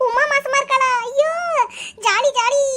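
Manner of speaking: high-pitched delighted squeal of words; overjoyed. Acted. Friend learns Uma is pregnant, overjoyed. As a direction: cheer it high and giddy.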